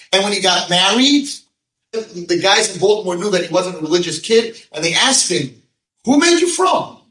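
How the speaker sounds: high, loud storytelling sermon. Real, not acted. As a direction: keep the hall lifted and stirred.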